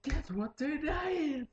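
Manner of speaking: excited teacher. Retold or imitated. Teacher quizzing the class about the day, teasing. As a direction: tease the class with a secret. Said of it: "I went to school and go".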